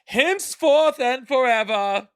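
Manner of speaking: loud, high, slow medieval proclamation; drawn-out, high-pitched medieval decree. Pretend. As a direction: declare it grandly like a medieval herald. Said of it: "I'll try to do a medieval voice".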